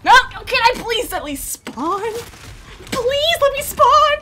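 high, loud, slow whining plea; mock begging. Real, not acted. Gamer begging the game to let him spawn, high whine. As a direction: beg the game, stretching the words.

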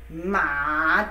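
Ma dipping low then rising; third tone ma. Shown as a demonstration. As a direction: dip Ma down low and back up. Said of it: "That is the third tone".

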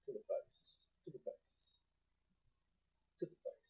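very soft whisper. Real, not acted. Player firing nail gun to the face, hushed whisper. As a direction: whisper it under your breath.

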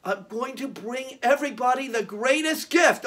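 normal talking voice

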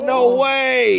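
high, loud disbelieving shout; surprised shout. Real, not acted. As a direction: squeal it in happy disbelief. Parent reacts to the gift.

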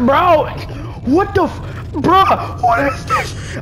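high, loud, slowed frightened cry; high-pitched scared yell; scared shout. Real, not acted. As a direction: shriek at the monster. Scared by monster.

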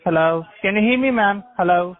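ordinary talk

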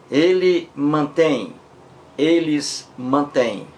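slow teacher's read of ele mantém and eles mantêm; slow, careful contrast. Shown as a demonstration. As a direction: spell it out slowly for the class. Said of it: "Ele mantém com acento aí agudo".

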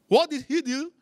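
usual speaking voice

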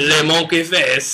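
put-on a lighter, slow French accent on le monkey face; a half-hearted French accent, still joking; mock French accent. Still needling his co-host with a light French accent. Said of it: "Your accents are so horrible".

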